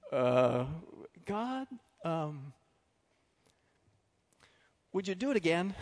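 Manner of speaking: a hint of an embarrassed Gideon asking again; sheepish Gideon.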